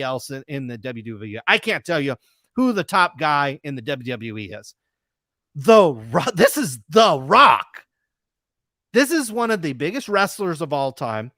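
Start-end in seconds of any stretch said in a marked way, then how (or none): 5.5-7.8 louder and slower, leaning on each word of "this is The Rock"; emphatic loud repeat. Real, not acted. Host repeating to viewers that it's The Rock, loud and slow. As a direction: hammer home "The Rock" slowly and loudly.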